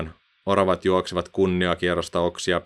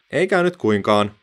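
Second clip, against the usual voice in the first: lightly raised, bright call to friends; cheerful apple voice; 4 semitones higher, 5 dB louder than usual. A narrator's reading. Fallen apple calls up to the others cheerfully. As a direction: reassure the others, a bit higher.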